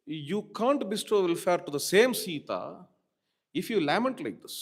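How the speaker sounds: ordinary talk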